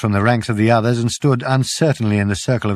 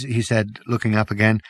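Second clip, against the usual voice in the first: plain reading voice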